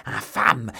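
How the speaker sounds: loud, furious cry; howled insult. A narrator's reading. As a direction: howl it in rage. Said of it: "hurlait Milady".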